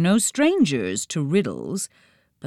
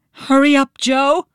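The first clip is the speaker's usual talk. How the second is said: higher, louder urgent push; urging; 8 semitones higher, 5 dB louder than usual. A narrator's reading. Nancy urging her brother to move, urgent and louder. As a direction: hurry him along in shock. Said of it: "Nancy urged, still in shock".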